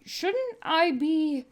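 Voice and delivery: timid, slow question; Terra's voice. Acted. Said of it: "cad cajoled them".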